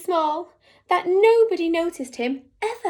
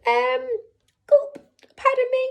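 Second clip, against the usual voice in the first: nervous squeaky mouse; 10 semitones higher, 5 dB louder than usual. Acted. Tiny mouse wakes the lion.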